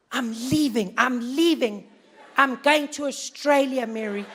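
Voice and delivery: worried emigrant. Retold or imitated. Worried whites fleeing.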